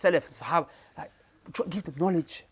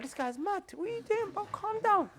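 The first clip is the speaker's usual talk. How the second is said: much higher, softer mock disbelief; a pitched-up imitation of startled brothers; baffled brothers; 12 semitones higher, 6 dB quieter than usual. Brothers reacting to being told shut up, higher, incredulous. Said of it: "They're gonna be like".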